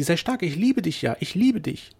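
high, loud declaration of love; pleading, ardent outburst; impassioned cry. A narrator's reading. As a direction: urge him on fervently, rising on "liebe". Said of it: "rief sie".

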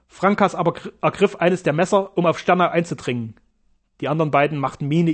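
ordinary delivery

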